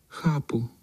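small voice giving a mild sigh; sighing cat. A narrator's reading. Cat conceding to the boy, lightly sighed high voice. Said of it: "A ona vzdychla".

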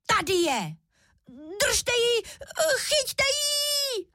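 piercingly high little cub cries; thin-voiced shouting. A narrator's reading. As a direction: shriek the alarm in a tiny high voice. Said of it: "začal svým tenkým hláskem křičet".

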